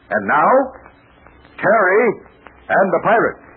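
title announcement, name rising; announcer. Acted. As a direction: announce the show with flair.

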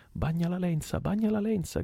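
very high urgent call; shouted order. Acted. Stab shouting to the tub oarsman, very high and urgent. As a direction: cry out to wet the line. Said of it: "gridò Stab al rematore".

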